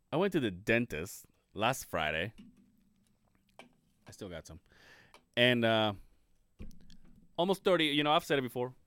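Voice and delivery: ordinary delivery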